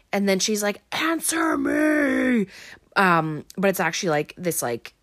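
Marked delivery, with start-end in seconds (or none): 0.9-2.5 slow, high, distorted demonic shout; angry demon voice. Retold or imitated. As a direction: bark it out like a possessed woman demanding an answer. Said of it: "angry, almost demon voice when she says it".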